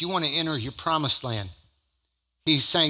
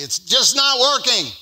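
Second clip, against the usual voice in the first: high, loud exasperated outburst; frustrated; 9 semitones higher, 5 dB louder than usual. Retold or imitated. Preacher quoting his own doubting words, acted frustration. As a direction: act out fed-up frustration, pitched up and pushed. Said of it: "I'm just frustrated".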